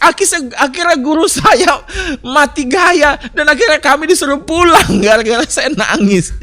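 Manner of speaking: high, loud, breaking into laughter; laughing while telling. Real, not acted. Preacher tells the congregation his funny story, laughing. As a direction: let the laugh slip through the words.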